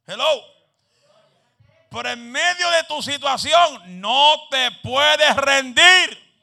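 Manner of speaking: loud, high, slow sermon shouting; preaching at full force. Acted. Preacher leading the congregation in a shouted refusal to give up. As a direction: call the congregation to shout with you.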